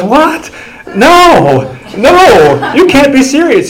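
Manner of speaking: high, loud squeal of disbelief; a shocked owner who can't take it in; stunned disbelief. Retold or imitated. Watch owner hearing the price, squealing in disbelief.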